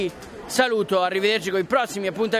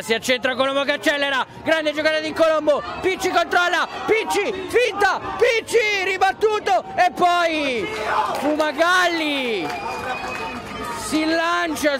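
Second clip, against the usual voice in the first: rising from lively to high shouting; building excitement; 7 semitones higher than usual. Real, not acted. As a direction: build the call as the chance grows. Building attack, shot blocked.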